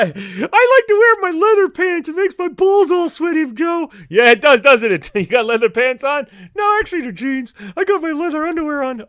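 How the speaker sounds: a cheeky stoner puppet griping about the dog; Mister Rob puppet voice. Acted.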